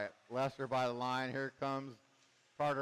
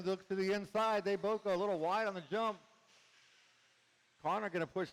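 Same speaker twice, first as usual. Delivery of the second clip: lively play-by-play over the track; race commentary; 3 semitones higher than usual. Real, not acted. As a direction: call the race as it unfolds.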